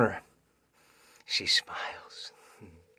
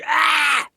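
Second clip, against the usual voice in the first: monster roar; 12 dB louder than usual. Acted. Girl turns monster and attacks.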